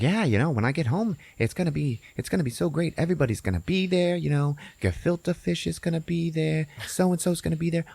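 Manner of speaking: high, chattering character voice; high-pitched excited rambler; Bagel. Retold or imitated. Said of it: "Bagel is like".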